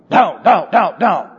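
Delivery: mild mock yell, repeated hammering words; mock shouting. Someone always shouting at home, mildly imitated scolding. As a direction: imitate a nagging shouter without much volume. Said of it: "someone was always shouting at you".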